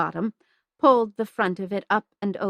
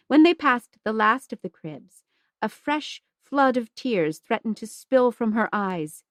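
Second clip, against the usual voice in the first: plain reading voice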